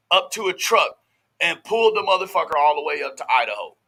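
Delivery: ordinary talk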